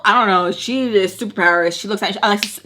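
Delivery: ordinary delivery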